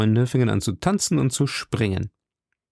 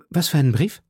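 higher-pitched, eager question; lively; 5 semitones higher, faster than usual. A narrator's reading. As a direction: call it out with sudden interest. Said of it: "rief Aramis lebhaft". (lebhaft gerufen)

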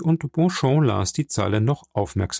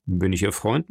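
plain reading voice

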